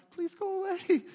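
high, squeaky frightened plea; mock scared plea. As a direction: beg the snake to leave, terrified. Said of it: "Inside, I'm like".

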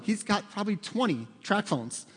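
usual speaking voice

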